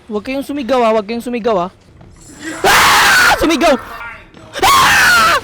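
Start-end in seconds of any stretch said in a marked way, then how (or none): 2.6-3.3 loud, high shriek; sudden full scream; startled scream. Real, not acted. Gamer scared right after banning screams, shrieking. 4.6-5.4 high, loud sustained shriek; long startled scream. Real, not acted. Gamer hit by another scare, screaming.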